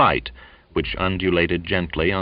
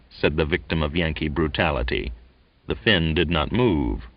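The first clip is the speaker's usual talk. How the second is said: ordinary delivery, nothing marked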